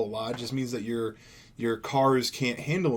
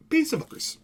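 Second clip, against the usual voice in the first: squeaky sign-off. Acted. Sign-off of vlog.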